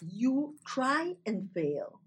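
ordinary talk, nothing marked

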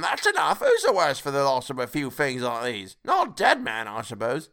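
high character voice; bold charwoman. A narrator's reading. Charwoman excusing the loss of a few things, brazen. Woman brushes off the theft.

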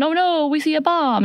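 high-pitched storyteller's alarm; mock alarm. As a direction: act out a sudden scare, high. Retelling a sudden bomb scare.

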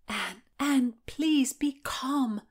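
slow soothing whisper; calm her gently, hushed. Acted. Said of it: "Margaret soothed".

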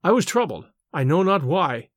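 ordinary delivery

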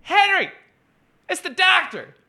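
put-on very high old lady voice calling away from the phone; high elderly wife calling to her husband; sweet old wife calling out. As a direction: call to the husband in a high doting voice. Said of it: "she's like".

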